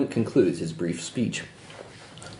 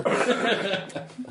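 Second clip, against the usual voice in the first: breathy laugh; 9 semitones higher than usual. Real, not acted. Laughs at trousers joke.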